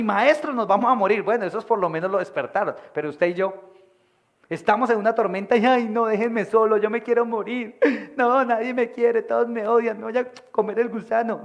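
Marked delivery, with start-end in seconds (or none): 0.0-1.2 high, loud frightened plea; panicked cry. Acted. Frightened disciples waking Jesus. 5.6-11.4 higher, louder whiny wail, a bit hammed-up; mocking a believer who gives up in the storm, whiny; mock self-pity.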